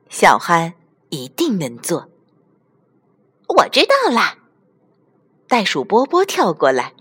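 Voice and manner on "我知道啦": high, excited kangaroo toy; excited cry. Acted. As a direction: burst out with a bright idea. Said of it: "兴奋地叫道". (兴奋地高声叫道)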